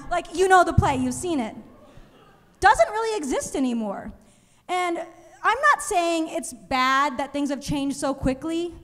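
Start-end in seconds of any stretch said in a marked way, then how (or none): none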